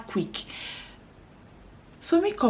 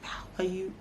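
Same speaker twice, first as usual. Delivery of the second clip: voiceless, clipped hello through annoyance; pissed whisper; 9 dB quieter than usual. Retold or imitated. Greeting a client in a whisper while she is pissed off. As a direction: whisper the greeting flatly, fuming. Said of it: "So I'll be like".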